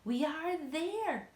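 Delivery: high, slow, kindly reassurance; forced cheer. A narrator's reading. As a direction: cheer the shaken friends up. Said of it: "trying to be cheerful".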